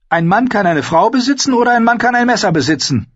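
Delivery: put-on high, bold young wildling woman; spirited young woman, teasing; Ygritte voice. Narrator voicing Ygritte.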